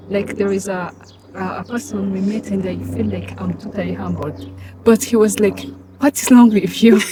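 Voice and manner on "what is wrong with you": loud, indignant mock retort; a stranger snapping back at a polite greeting, loud; retold loud challenge. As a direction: throw it back at her loudly, mock-offended. Retold rude reply to humble greeting.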